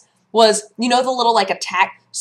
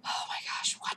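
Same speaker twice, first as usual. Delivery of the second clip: stunned whisper; 16 dB quieter than usual. Retold or imitated. Retold dismay at losses.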